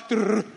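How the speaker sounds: short rolled trill for an aftershock; imitated tremor noise. Preacher mimics a sudden aftershock, short rolled rumble. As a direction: roll out a sudden rumbling trr. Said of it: "de repente se escuchaba".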